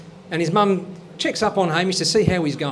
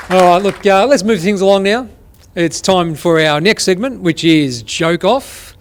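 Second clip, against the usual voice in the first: show host announcing; 7 dB louder than usual. Acted. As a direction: sell the next segment to the crowd. Host introduces next segment.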